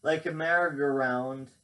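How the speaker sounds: reads each line out as a poem; poem reading. Acted. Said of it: "I'll read it to you".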